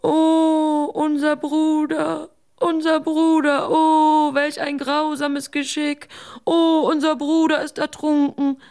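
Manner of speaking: high, loud wailing for the lost brother; hammed-up wail of despair; wailing lament. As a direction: cry out in mock grief. Lament over drowned brother.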